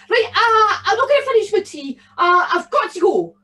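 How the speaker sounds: high, fast, gleeful little goblin voice; a giddy bogle who can't sit still; excited bogle. Acted. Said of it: "rubbing his long nose in excitement".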